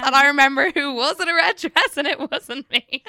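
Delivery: imitating mum's outraged protest; indignant mum. Mum snapping back at dad about the red dress, loud mock indignation. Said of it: "And my mum's like".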